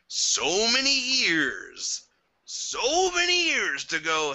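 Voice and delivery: high, drawn-out wail of disbelief; exasperated. Real, not acted. Fan ranting about makers who had years to fix it, exasperated. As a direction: stretch every word out in rising exasperation.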